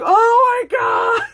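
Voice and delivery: imitated high panicked screams; monster screaming. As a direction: scream for help like a dying crew member. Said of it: "her screaming".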